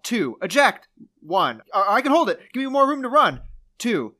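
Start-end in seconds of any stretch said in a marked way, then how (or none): 0.4-0.9 higher, louder command; urgent wingman. Retold or imitated. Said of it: "I'll say person one and person two". 1.7-3.4 higher, louder pilot in trouble; panicked pilot. Retold or imitated. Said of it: "I'll say person one and person two".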